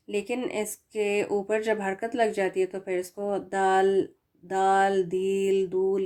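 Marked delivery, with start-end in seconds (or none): 4.4-6.0 دال دیل دول with long drawn vowels, wrong way; wrong vowel readings. Shown as a demonstration. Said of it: "اس طرح سے تو نہیں پڑھا جاتا". (غلط طریقہ، لمبی آواز کے ساتھ)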